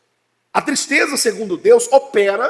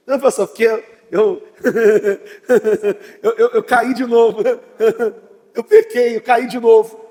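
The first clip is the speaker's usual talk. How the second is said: giggling while admitting the sin again; laughing confession; 4 dB louder than usual. Retold or imitated. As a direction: laugh it off, louder and careless. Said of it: "mas você tá rindo?".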